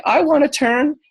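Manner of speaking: high, eager mock voice; eager Dane. Dane stepping out to ask for a turn, high and eager. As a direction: jump in like a keen kid wanting a go. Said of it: "He's like".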